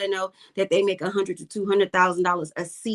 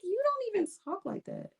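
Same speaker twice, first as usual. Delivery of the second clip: incredulous callout; 11 semitones higher, 9 dB quieter than usual. Retold or imitated. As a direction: voice jumps much higher, softer, calling her out. Calling out mom's code switching.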